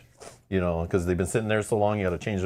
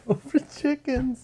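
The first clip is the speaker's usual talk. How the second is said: laughing; 14 semitones higher, slower than usual. Real, not acted. Amused repeat of name.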